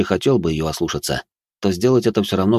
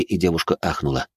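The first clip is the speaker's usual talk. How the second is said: ordinary delivery, nothing marked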